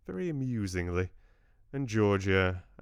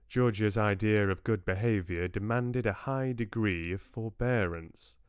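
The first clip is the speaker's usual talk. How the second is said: ordinary delivery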